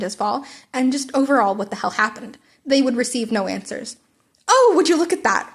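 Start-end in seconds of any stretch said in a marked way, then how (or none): 4.5-5.5 louder, higher booming call; bellowed. Acted. All Might bellows to dodge the class's questions. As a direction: boom it out to change the subject. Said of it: "All Might bellowed".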